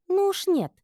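very high, loud refusal; sudden declaration. A narrator's reading. Friend refuses to give up slave, emphatic. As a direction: blurt it out in protest. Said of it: "внезапно заявила Табита".

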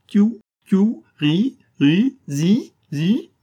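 high loud bird-like chirps through a gag; shrill peeping from a stopped mouth; gagged chirping. A narrator's reading. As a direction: chirp like a muzzled prisoner. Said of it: "ponctuer le discours de fouinard de Kirikiou".

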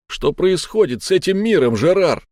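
high, loud exasperated cry; heated outburst. Acted. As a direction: blurt it out in despair. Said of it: "в сердцах выпалил Монокль". (выпалил в сердцах)

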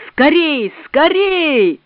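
rising, hurried call; urgent shout. A narrator's reading. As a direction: hurry them along, calling out. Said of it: "кричал он мне".

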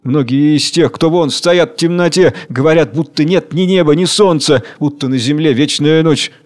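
ordinary delivery

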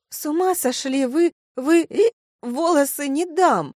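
high, loud protest broken by hiccup; tipsy indignation. Acted. Kelsey refuses to let friends cut hair, indignant. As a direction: refuse in shrieky drunken outrage.

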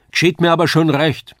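high, loud indignant dialect; quarrelling wife. A narrator's reading. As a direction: lament loudly at your husband. Wife resumes loud marital quarrel.

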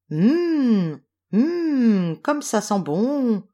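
long, swooping high hums of delight; high, slow, appreciative exclamation. A narrator's reading. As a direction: savour a lovely smell, drawn out. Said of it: "s'exclama Boucle d'Or".

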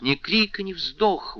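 ordinary delivery

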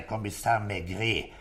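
ordinary talk, nothing marked

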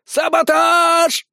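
put-on high, drawn-out old woman's yell. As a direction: bellow it long and high. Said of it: "заорала бабка". (протяжный крик)

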